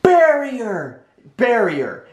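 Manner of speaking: high-pitched imitated yell, drawn out; mock yell of a sudden command; top-of-lungs yell. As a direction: call it out high, falling away. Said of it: "she yells at the top of her lungs".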